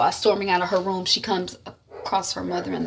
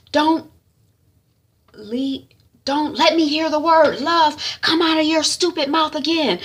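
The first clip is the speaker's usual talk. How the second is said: raised, higher motherly scolding; angry warning; 8 semitones higher, 4 dB louder than usual. Retold or imitated. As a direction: forbid her in acted anger. Mother forbids word love.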